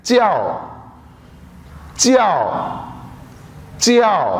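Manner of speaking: च्याओ with a sharp falling tone; Chinese tone demo. Shown as a demonstration. Teacher drilling च्याओ for students, falling tone. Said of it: "अगला जो word है नाज़रीन वो है".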